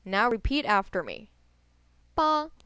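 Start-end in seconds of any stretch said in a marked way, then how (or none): 2.2-2.5 ba held high and level; high flat tone on ba. Shown as a demonstration. Teacher models number eight to repeat, high flat ba. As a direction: say ba on a high, even pitch. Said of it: "same tone as number one and seven".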